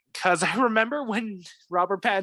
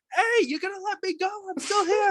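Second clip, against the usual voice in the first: much higher, strained comic character voice; Penguin calling out. Acted. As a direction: plead in a high put-on voice to be let go. Acts out tied-up Penguin calling out.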